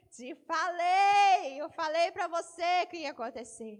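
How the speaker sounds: very slow, loud I told you; Jesus reproaching. Acted. Preacher voicing Jesus' look at Peter, slow and loud. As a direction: drag it out, grieved and loud. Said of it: "Jesus olha pra ele assim".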